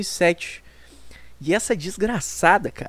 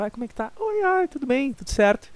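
put-on high, sweet girlfriend reply, much higher pitched; 6 semitones higher, 4 dB quieter than usual. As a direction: play the girlfriend answering in a high voice.

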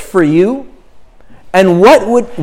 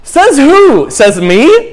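high, loud scoffing challenge; a voice that refuses to believe it; sceptical retort; 7 semitones higher, 3 dB louder, slower than usual. Acted. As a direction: throw the question back, much higher and louder. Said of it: "doubting the affirmation".

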